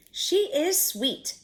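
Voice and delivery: gasped in delight. A narrator's reading. Old woman sees the decorated cookie, gasping in delight. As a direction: gasp it out in awe of the cookie. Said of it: "gasped the woman".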